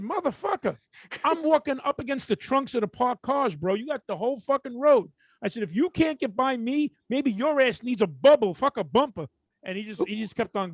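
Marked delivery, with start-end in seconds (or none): 0.0-9.3 raised pitch, exasperated street argument; pissed-off retort. Retold or imitated. Storyteller retelling his argument with the driver, pissed-off retort. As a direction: argue back, fed up and incredulous. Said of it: "I'm getting pissed now".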